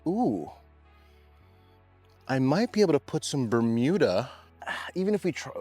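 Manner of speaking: ordinary delivery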